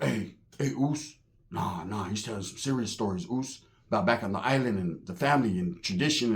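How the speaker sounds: low, soft aside from a friend; quiet, low cautioning; hushed warning. Retold or imitated. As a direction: discreetly warn your buddy, keeping it down. Homie quietly warns him mid-story.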